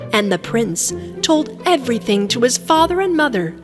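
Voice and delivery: plain reading voice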